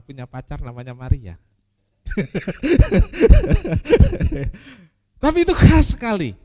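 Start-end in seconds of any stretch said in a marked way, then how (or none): 2.1-4.6 loud, high laughter; high-pitched chuckling fit; amused laugh. Real, not acted. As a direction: burst out laughing at your own aside. Chuckles at his own aside about Maria.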